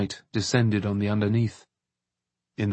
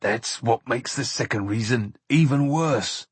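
put-on slightly choked, in an older companion's voice; understated, strained grief; 3 dB quieter than usual. A narrator's reading. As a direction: hold back distress while explaining. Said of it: "said Bert in a choked voice".

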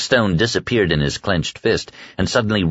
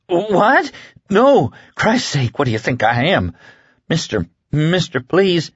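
horrified protest; 10 semitones higher than usual. A narrator's reading. Lloyd denying to Flagg that he ate his cellmate, panicked. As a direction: recoil from the accusation in panic.

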